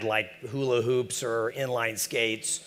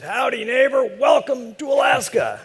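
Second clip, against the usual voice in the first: big high hollered howdy; loud old-timer calling out a welcome; hearty neighbor greeting; 12 semitones higher, 6 dB louder than usual. Retold or imitated. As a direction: boom out a folksy welcome, higher and louder. Old Alaskan neighbor greets newcomer.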